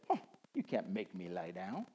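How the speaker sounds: low, quiet scoff of defiance; proud sheep. Acted. Proud sheep to the shepherd, low defiance. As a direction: play a stubborn sheep digging in. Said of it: "in my pride as a sheep".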